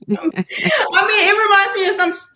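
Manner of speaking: getting the words out while still laughing. Real, not acted. Laughing at the breakfast plate.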